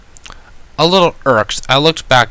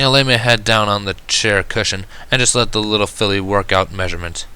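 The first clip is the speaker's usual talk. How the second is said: usual speaking voice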